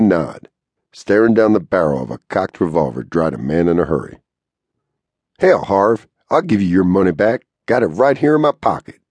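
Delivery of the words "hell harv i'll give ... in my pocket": lightly voiced cowboy, pitched up; a touch of higher-voiced pleading at gunpoint; hint of a cowboy talking his way out; cornered cowboy.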